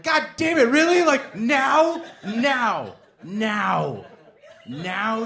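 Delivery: high, loud, exasperated yell, fading at the end; mock outraged yell. Turned away at the door in the freezing night, yelling in disbelief. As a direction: shout in disbelief, then wind down. Retold outrage at being refused.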